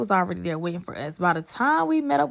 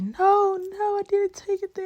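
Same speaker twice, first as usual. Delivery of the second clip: high panicked denial; 12 semitones higher than usual. Retold or imitated. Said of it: "I'm just like".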